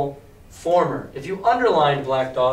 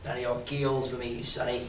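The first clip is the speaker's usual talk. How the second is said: low pirate mutter; 4 semitones lower, 10 dB quieter than usual. A narrator's reading. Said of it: "he said".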